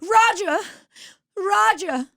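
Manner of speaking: very high mock-frightened call; scared Mid-Atlantic accent. Castaway whom Roger ignores, high mock fright. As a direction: call out helplessly, old-film damsel style. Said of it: "When she gets really scared".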